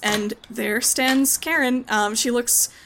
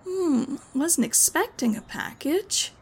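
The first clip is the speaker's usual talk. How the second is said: a curious woman not expecting mail; puzzled Karen; 6 semitones higher, slower than usual. Acted.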